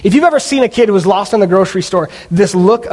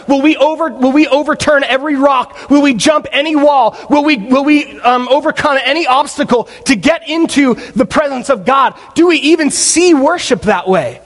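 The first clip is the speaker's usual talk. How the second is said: fervent preaching; 6 semitones higher than usual. Acted. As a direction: preach it with building fire. Preacher building to full force.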